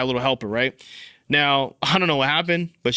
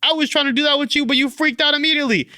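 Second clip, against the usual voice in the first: very high-pitched exasperated outburst; acted-out mad complaint; retold anger; 13 semitones higher, 4 dB louder than usual. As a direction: burst out in indignant frustration, voice way up high. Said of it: "that made me even more mad".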